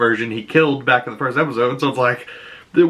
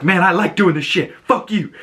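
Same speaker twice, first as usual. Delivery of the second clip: cocky; 8 semitones higher than usual. Retold or imitated. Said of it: "He's like".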